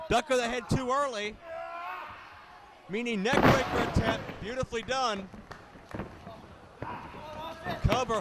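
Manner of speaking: live play-by-play call; wrestling commentator. Acted. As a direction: call the match as it happens.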